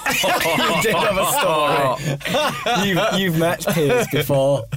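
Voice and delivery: drawn-out, high, through laughter; laughing insistence. Real, not acted. Prodding a friend for the story about Piers, laughing insistence.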